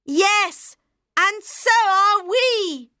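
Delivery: high, slow happy cheer; joyful chorus. Acted. Seven dwarfs shouting their joy to the king. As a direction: cheer together. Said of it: "shouted the seven dwarfs".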